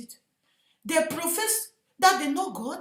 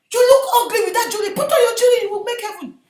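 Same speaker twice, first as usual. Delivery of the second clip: loud, higher, quick mimicry; imitated pastor exhorting; 9 semitones higher, 9 dB louder, faster than usual. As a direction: mimic a pastor urging the flock.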